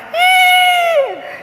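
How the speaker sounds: high gasping sob, sharp intake of breath; hysterical gasp. Retold or imitated. Retelling a panicked call to her sister, high hysterical gasp. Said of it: "so hysterical".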